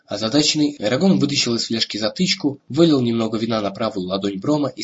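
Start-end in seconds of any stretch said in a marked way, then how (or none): none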